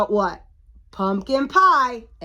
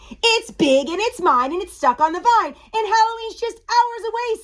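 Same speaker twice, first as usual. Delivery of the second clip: high, loud complaint climbing higher; frantic witch, pitch rising; exasperated witch; 11 semitones higher, 5 dB louder than usual. A narrator's reading. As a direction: wail in storybook exasperation.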